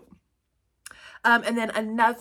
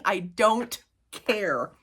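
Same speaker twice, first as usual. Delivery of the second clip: ordinary talk, nothing marked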